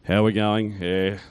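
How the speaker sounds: ordinary talk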